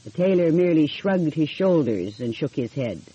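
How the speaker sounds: ordinary delivery, nothing marked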